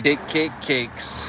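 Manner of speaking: teasing slow repetition; playful drawl. Pretend. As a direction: savor each cake. Playful repeated word.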